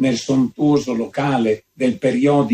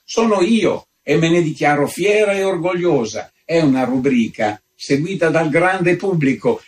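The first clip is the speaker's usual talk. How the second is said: proud; 6 semitones higher than usual. A narrator's reading. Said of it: "esclamò la contessa".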